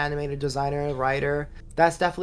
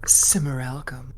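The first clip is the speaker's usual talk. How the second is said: breathy, slow seductive whisper; sexy commercial voice. Pretend. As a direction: purr it like a lingerie ad. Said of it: "Say it like a sexy commercial for lingerie".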